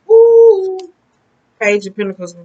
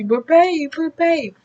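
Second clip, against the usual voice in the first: much higher whiny begging; mock pleading partner; 10 semitones higher, much slower than usual. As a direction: whine for attention like a needy lover.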